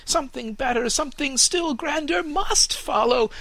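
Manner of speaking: put-on the young tree now insisting it must come; urgent young fir tree; insistent fir tree. Fir insists on grander things.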